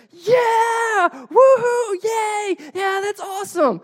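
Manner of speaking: very high, loud cheering shriek; excited scream. Retold or imitated. Dad mimicking his thrilled son in the next room, excited scream. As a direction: imitate a child screaming with sheer excitement. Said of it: "like, really excited scream from Zayden".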